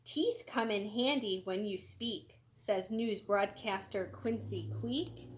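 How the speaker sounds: lively, sing-song storybook reading; animated picture-book read-aloud; reading to children. Acted. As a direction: read it bright and bouncy for kids. Reads broadcaster's quote.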